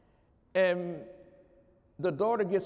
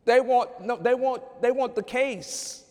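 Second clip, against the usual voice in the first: higher and louder, pressing the point home; preaching at full force; 6 semitones higher, 6 dB louder than usual. Acted. Preacher driving the lesson to the church, preaching loud. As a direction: hammer it to the congregation like a preacher.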